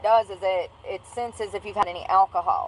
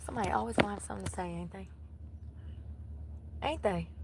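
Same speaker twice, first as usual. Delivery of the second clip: muttering to self; 2 semitones lower, 14 dB quieter, faster than usual. Real, not acted. Quiet muttered complaint.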